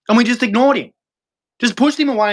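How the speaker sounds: rising exasperation. Real, not acted. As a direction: hit it with disbelief. Ranting about ignoring Jesus.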